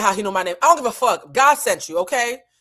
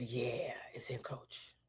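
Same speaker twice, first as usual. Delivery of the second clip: whispered, softer; hushed bad news; 8 semitones lower, 19 dB quieter, much slower than usual. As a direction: break it gently, almost unsaid. Agent breaks bad news.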